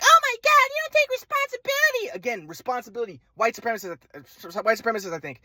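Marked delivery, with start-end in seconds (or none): 0.0-2.2 much higher mocking mother; mock mom voice. Son mocking his mother's insults on the phone, high-pitched. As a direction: parody mom's insults in a high voice. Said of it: "She's like".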